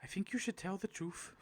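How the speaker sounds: amateur mock-Irish voice; bad Irish accent. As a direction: try an Irish accent and not quite get it. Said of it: "I can't do an Irish accent".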